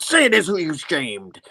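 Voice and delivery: laying into him. Acted. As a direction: demand it accusingly, drawing out the end. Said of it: "really laying in to Norman".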